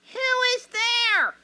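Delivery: put-on high, slow frail old woman; grandmother voice. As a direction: quaver it like a weak granny. Grandmother answers the door.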